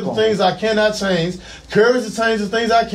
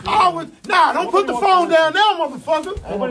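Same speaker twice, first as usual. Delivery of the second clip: pitched way up, frantic; panicked high yelling; 8 semitones higher, slower than usual. Real, not acted. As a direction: yell in real panic. Man resisting forced restraint.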